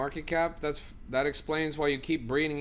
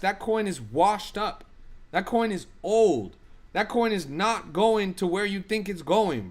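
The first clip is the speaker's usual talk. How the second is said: worked up; 4 semitones higher than usual. Real, not acted. Telling chatter Clemens his coin is dead, exasperated. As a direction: hammer each word home. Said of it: "I'm getting worked up right now".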